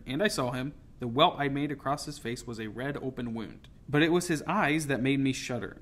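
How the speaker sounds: plain reading voice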